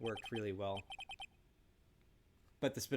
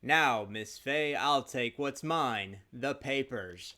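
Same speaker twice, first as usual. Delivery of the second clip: slow, deliberate villain; menacing murderer; 5 dB louder, much slower than usual. Acted. Murderer demanding the papers from Miss Fay, slow menace. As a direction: take your time, savouring each demand.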